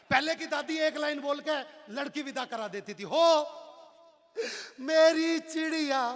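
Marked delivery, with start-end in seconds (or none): 3.1-6.0 loud, high, slow keening lament; slow, loud, high mock-tearful keening; grandmother's wail. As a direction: wail like an old granny seeing off the bride. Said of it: "अब दादी ने रोना start किया".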